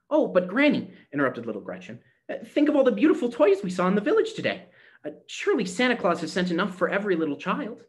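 put-on a bright girl insisting on hope; eager little girl. A narrator's reading. Gretchen eagerly interrupts Granny about the toys, high and pleading.

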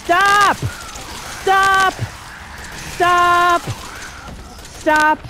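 high, loud single-word yells; real high-pitched cry to stop; panicked shouting. Real, not acted. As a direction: shout it as if under attack. Panicking under attack in game.

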